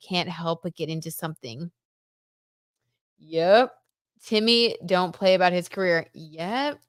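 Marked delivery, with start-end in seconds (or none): none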